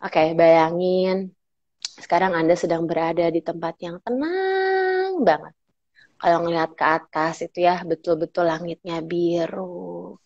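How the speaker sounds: slow, soft hypnotic guiding voice; wizard tone. Shown as a demonstration. Trainer guiding someone to imagine a peaceful place, soft wizard tone. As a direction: lull them into calm, stretching the words out. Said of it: "terus ada yang namanya wizard".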